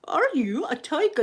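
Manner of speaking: high, swooping character voice; questioning toy dinosaur. Acted. Dinosaur questioning the zebra in a game, high toy-dinosaur voice. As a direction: pitch it up as a toy dinosaur querying. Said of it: "and he goes".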